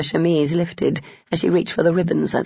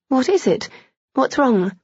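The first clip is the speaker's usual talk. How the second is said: worried; 8 semitones higher than usual. A narrator's reading. Heroine alarmed by his groan.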